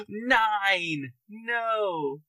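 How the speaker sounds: sharp dismayed cry at the roll. Real, not acted. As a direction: react as if the roll just ruined you. Reacting to dice roll.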